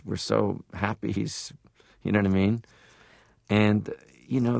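usual speaking voice

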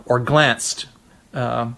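ordinary talk

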